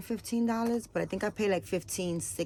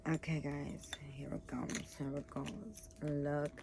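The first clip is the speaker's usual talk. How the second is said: soft whisper, much softer; 3 semitones lower, 9 dB quieter than usual. Real, not acted. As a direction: hush the viewers before the reveal. About to reveal the nipple.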